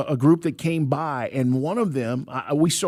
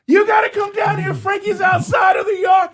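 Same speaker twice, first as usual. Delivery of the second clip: urgent call; 14 semitones higher than usual. Retold or imitated. Wife calling the host about the escaped dog. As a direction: call up in a panic. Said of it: "Michelle's like".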